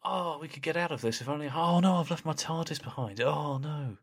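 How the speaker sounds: high, fake-innocent feigned dismay; hammed-up oh no; mock innocent Doctor. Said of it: "the Doctor goes".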